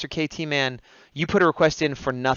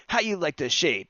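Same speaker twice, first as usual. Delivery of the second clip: ordinary delivery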